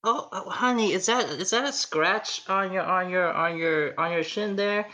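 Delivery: fussing mom. Acted. Mom fussing over son's scratch.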